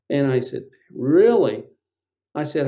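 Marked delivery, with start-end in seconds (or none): 0.9-1.6 louder, drawn-up surprised "Really?". Retold or imitated. Patient answering the nutritionist's parasite verdict, surprised. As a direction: retell your startled disbelief with a push.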